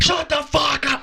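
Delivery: imitated outburst, pitched far up; sudden crazed snap. Host mimicking the Penguin's sudden rage, high-pitched outburst. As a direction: flip like a switch into a high yell. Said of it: "and then out of nowhere".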